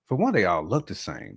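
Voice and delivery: ordinary delivery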